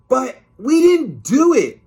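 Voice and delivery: high, loud whiny protest. Acted. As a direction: wail your innocence like a kid. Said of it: "George protested".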